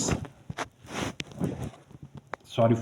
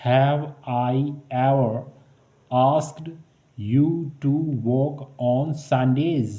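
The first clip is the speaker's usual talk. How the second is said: very slow, word by word; slow teaching read; slow drill; much slower than usual. Shown as a demonstration. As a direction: drag the question out for learners. Slow model English question.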